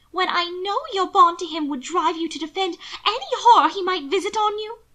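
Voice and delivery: high, impassioned sister voice; pained disbelief. A narrator's reading. Sister laments her brother's bond to his husband, high and pained. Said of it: "she breathed".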